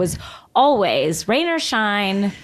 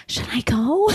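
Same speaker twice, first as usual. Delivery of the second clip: whispered nervous question; timid whisper, barely voiced; 4 semitones higher than usual. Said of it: "be like".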